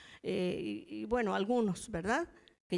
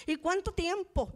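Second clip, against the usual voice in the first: proud, pitched-up challenge; haughty high question; 8 semitones higher, 3 dB louder than usual. Retold or imitated. Disciplined singer to pastor, proud and haughty. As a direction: toss it back with pride. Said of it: "Bien orgullosa".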